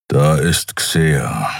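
low, slow grumble; grumbled. A narrator's reading. Kero finally answering the captain, low grumble. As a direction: grumble it out reluctantly. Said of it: "brummte Kero schließlich".

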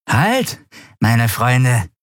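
put-on high, thin young-sounding voice of a wasted junkie; grotesk junge Stimme. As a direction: call out with an eerily youthful pitch. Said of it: "eine grotesk junge Stimme". (hohe, grotesk junge Stimme)

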